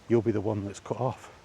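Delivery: usual speaking voice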